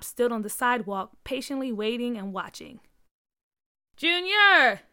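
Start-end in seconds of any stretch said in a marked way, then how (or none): 4.0-4.8 high, loud call upstairs; screaming. A narrator's reading. Mom screaming for Junior to come down. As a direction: yell up at her son. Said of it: "Junior's mom screamed".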